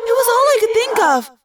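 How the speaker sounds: high, loud flustered protest; defensive. Acted. Cassie excusing her squirrel morph, defensive. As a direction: blurt out an excuse.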